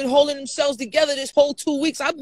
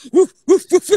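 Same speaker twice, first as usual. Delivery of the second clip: higher, loud short whoops; imitated crowd whoops; 4 semitones higher, 6 dB louder than usual. Woken friend mimics crew filming her, loud whooping.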